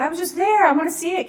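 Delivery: eager. Retold or imitated. Begging to see the paper.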